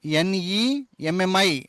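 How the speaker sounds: ఎన్ఈఎంఎంఐ spelled out very slowly, letter by letter; slow spelling. Shown as a demonstration. Teacher slowly spelling నెమ్మి for the class. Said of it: "ఈ ఒత్తుకి ఎమ్ మధ్యలో".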